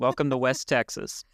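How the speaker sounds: hammed-up mild West Texas twang; bad West Texan accent. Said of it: "That was not a good West Texan accent".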